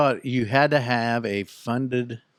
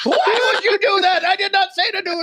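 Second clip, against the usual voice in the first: shrieky high-pitched scolding; mock scammer fury. Scammer scolding old lady's wrong click, high mock outrage. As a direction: berate in a put-on, very high voice.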